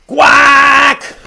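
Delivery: one long, loud, high quack; angry duck squawk; mad duck. Acted. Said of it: "Mad duck".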